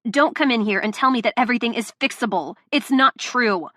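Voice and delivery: angry rant. Acted. Delilah berating Luca, angry and loud. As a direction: tear into him. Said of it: "this rant".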